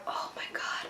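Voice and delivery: whispered dismay, barely any sound; aghast whisper. Retold or imitated. Girl reacting to his seat-swap request, aghast whisper. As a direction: whisper it under your breath, appalled.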